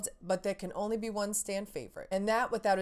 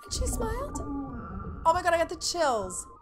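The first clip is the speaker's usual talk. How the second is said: doting coo; 9 semitones higher than usual. Real, not acted. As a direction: coo it like a besotted parent. Cooing over the baby.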